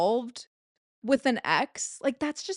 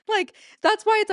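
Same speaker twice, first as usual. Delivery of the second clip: laughing; 11 semitones higher, 6 dB louder, faster than usual. Real, not acted. Laughing at her own outburst.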